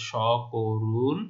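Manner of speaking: স করুণ as "shɔ-korun", broken into syllables; sh sound in স করুণ. Shown as a demonstration. Teacher showing the spoken form, স করুণ as "shɔ-ko-run". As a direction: say স as "sh" and draw out করুণ. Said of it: "আমরা স এর মতো".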